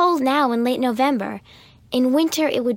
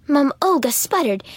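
usual speaking voice

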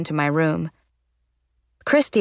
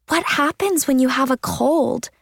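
higher-pitched, concerned asking; worried question; 6 semitones higher than usual. A narrator's reading. Friend fretting over the nose ring, worried question. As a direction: ask it with worry in the voice. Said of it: "with a worried look on her face".

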